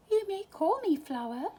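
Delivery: high, sweet little voice; little skunk voice. A narrator's reading. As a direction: introduce yourself shyly. Shy skunk introducing herself.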